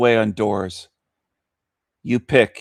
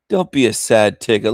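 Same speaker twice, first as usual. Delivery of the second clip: normal talking voice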